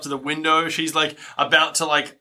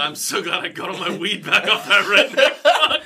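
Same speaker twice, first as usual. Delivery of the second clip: giggling through a stoner line; imitating Jason as a happy stoner, laughing; laughing impression; 3 semitones higher than usual. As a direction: laugh it out, pleased with yourself. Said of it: "He's like".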